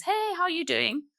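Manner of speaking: mock a careless greeting up high; flippant greeting. Someone casually greeting a grieving mother, flippantly. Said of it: "the flippant words".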